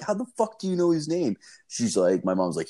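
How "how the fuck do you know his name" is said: high, baffled retold question; incredulous. Son asking mom how she knows the thief, incredulous. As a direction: demand an answer in pitched-up disbelief.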